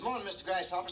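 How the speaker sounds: high, loud, chirpy hello; cheery mouse greeting. Acted. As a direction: call it out brightly as a happy little mouse. Happy mouse greeting everybody in story.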